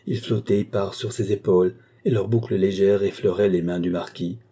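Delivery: ordinary delivery, nothing marked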